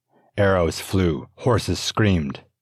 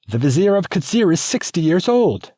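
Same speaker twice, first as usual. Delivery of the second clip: much higher, loud, indignant; angry protest; 8 dB louder than usual. Acted. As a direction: spit out the disgust. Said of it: "She was angry".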